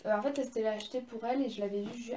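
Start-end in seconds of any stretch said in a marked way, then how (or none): none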